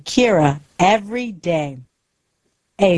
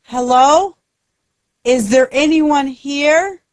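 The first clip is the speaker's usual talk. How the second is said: higher-pitched call into empty surroundings; mild shout; 8 semitones higher than usual. A narrator's reading. Lost character calls for anyone nearby, mild shout. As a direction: call out for anyone who might hear. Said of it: "He shouts".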